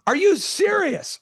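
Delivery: very high, drawn-out mock outrage; slow, high-pitched incredulous cry; retold aggressive disbelief. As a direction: imitate an exasperated pastor, stretching the words. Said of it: "And he's so aggressive".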